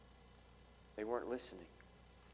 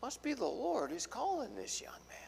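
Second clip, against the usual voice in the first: hushed, higher whisper of a puzzled priest; 9 semitones higher, 6 dB quieter than usual. Retold or imitated. Eli wondering who calls the boy, whispered. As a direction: breathe it out like a private thought.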